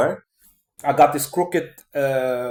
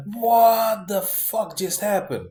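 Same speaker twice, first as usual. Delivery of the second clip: high and very slow, half-disbelieving; reliving the moment it all sank in; stunned panic; 7 semitones higher, much slower than usual. Retold or imitated. Panicked after chat reply.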